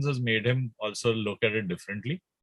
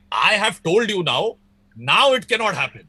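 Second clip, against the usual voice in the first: higher, louder mock-insistent impersonation; Sid K; 6 semitones higher, 5 dB louder than usual. Imitating a friend's brother in a debate, hammed-up insistence. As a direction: lay down the law in exaggerated protest. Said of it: "That's a great Sid K impersonation".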